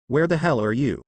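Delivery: usual speaking voice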